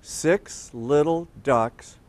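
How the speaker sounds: slow, word-by-word reading; read-along. Shown as a demonstration. Host reading together with kids, slow and word by word. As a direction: read slowly, spacing each word for children to follow. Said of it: "Let's read it together".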